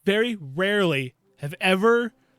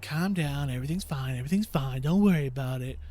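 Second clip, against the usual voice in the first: softly soothing wife; a soft, soothing wife calming her panicking husband; calm reassurance; 5 semitones lower, 4 dB quieter than usual. Retold or imitated. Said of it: "and his wife's like".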